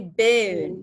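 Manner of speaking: bone said as beun; British bone. Shown as a demonstration. Teacher explaining the British bone. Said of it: "karena dia British dan pakai diftong ini".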